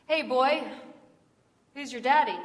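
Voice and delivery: lightly voiced heckler calling out; mock taunt. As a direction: needle the boy, mildly acted. Townsfolk taunting the boy.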